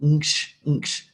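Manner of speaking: NKSH as one isolated blended noise; nasal NG running straight into SH; consonant cluster. Shown as a demonstration. Teacher showing a cluster to students, blended NKSH demo. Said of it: "combined into one sound".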